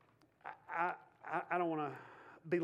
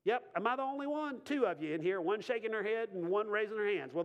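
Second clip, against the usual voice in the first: usual speaking voice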